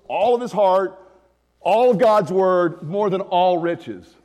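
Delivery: all leaned on slowly and loud each time; stressing all. Shown as a demonstration. Preacher reading the three totals to the church, slow and stressed. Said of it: "Those three totals".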